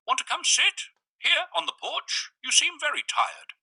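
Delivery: the man's voice. A narrator's reading. Visitor inviting the tired narrator to sit, high and gentle.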